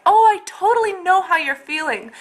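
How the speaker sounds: mocking sympathy. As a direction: parody people who claim to understand. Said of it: "when people are like".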